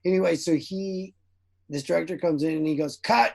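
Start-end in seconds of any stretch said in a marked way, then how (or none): 3.0-3.3 higher, louder barked "Cut!"; sharp cut call. Retold or imitated. Retelling the director halting his scene, loud cut call.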